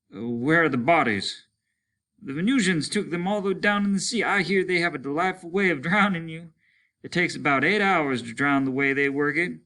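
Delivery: bitter grim dialogue; lightly voiced weary soldier. Soldiers discuss the attack.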